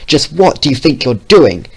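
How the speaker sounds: angry shout at Green. Acted. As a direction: yell it at him. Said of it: "he shouted".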